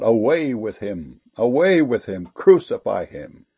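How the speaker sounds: ordinary delivery, nothing marked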